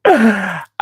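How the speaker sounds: high cackling laugh. Real, not acted. Laughs at his own joke to chat.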